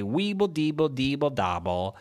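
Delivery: silly made-up crayon gibberish; crayon language. Acted. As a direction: chant nonsense words like a crayon. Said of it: "talk in crayon language".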